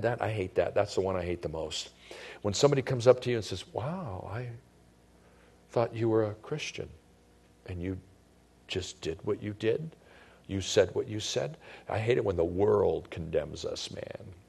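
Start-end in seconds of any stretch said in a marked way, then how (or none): 3.7-11.6 soft, halting, mildly acted shock at someone's behaviour; hushed disapproval, pausing as if lost for words; judgmental disbelief. Acting out a judgmental fellow believer.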